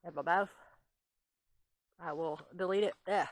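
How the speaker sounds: normal talking voice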